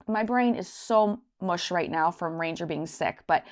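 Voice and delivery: normal talking voice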